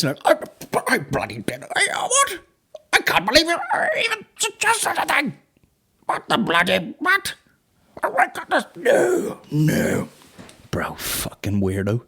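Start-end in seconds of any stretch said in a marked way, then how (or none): 0.2-10.1 very high, squeaky spluttering protests; lying denier. Acted. Comic mimicking a creepy man's lying denials, squeaky spluttering falsetto. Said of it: "his li-, his lying face".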